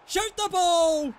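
high, loud command; exasperated shout. Real, not acted. Gamer ordering his striker to shoot, exasperated shout. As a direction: yell it at the screen.

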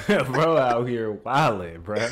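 laughing while talking. Real, not acted. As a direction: laugh through the words. Laughing at wild story.

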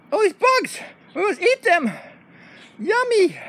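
high-pitched little bug hunter; eager dog voice. Pretend. Patrol dog finds bugs to eat, high eager voice. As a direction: squeak it as a hungry dog.

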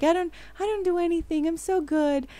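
mock-innocent, playing the shy good girl; imitating shy Esther. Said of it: "she started being, like, so shy".

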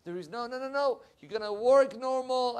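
ordinary talk, nothing marked

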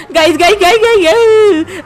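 yelping to the guys in hammed-up panic; mock panic. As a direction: call out in rising, playful alarm. Panicked cry to viewers mid-chase.

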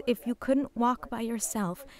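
ordinary talk

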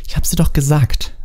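put-on high, cross girl voice; high-pitched exasperation; angry snapping. A narrator's reading. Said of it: "fauchte Hermine zornig".

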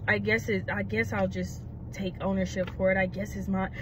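low, quiet mock confession; reluctant imitation. Friend owning up to police, low and reluctant.

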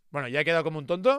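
ordinary delivery

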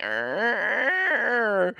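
long drawn-out imitation of a creaking door; slow door creak. Teller mimics the door he is opening, slow creak. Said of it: "like, all slow, and it's like".

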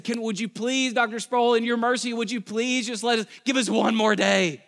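high begging whine; mock student begging for mercy; pleading students. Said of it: "and they said".

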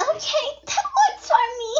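very high, squeaky character voice; agreeable game character. Acted. Game girl agreeing to a meeting time, high and perky. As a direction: pitch it way up like a perky heroine.

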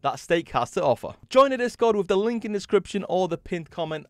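upbeat sponsor ad voice; ad read. Acted. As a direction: pitch the offer brightly. Sponsor ad read mid-video.

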